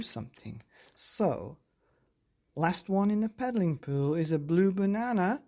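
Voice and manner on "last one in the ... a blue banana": put-on child's playful shout, slightly raised. A narrator's reading. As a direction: call out a race challenge. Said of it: "shouted Hal".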